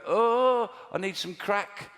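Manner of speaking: high, drawn-out mock moan; mock craving. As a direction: imitate a needy moan. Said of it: "they go".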